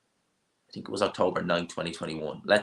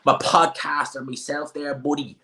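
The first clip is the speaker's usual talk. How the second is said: put-on higher, drawling Dublin wide-boy voice; mocking Dublin accent; 6 semitones higher, slower than usual. Streamer mimicking Dubliners at the press conference, mocking Dublin accent. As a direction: put on a thick Dublin accent, stretching the phrases. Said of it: "I don't even wanna be listening to that".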